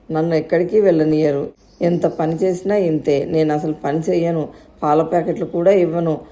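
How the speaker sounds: ordinary delivery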